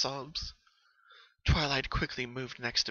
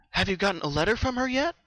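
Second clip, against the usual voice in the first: put-on higher, louder studious young mare; Twilight, studious; 8 semitones higher, 8 dB louder, faster than usual. Twilight questioning Pinky about her dreams, studious. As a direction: ask it like a curious scholar.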